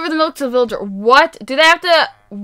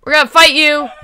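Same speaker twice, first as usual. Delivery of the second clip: ordinary talk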